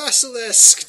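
loud, high cry of alarm; alarmed warning. Acted. Loris warning the party the basilisk is here, alarmed shout. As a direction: shout the warning at your companion.

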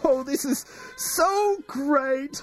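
high, slow gushing thanks; overwhelmed with joy; tearful delight. Acted.

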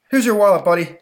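loud, high panting crook; shifty petty crook. Acted. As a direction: blurt it out on the run. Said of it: "says the guy running up to Johnny".

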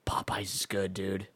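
hushed whisper. Real, not acted. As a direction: whisper it like a secret. Unvoiced whisper.

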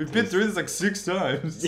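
laughing. Real, not acted. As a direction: laugh through the complaint. Laughing about audio again.

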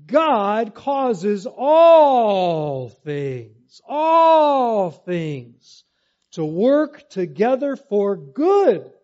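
all drawn out, loud and slow; all things, all things, preached loud; stress on all. Shown as a demonstration. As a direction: hammer the word all. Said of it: "It says".

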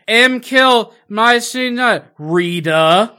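slow, loud mangled alien voice. Retold or imitated. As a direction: drag out strange alien words. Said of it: "alien voices".

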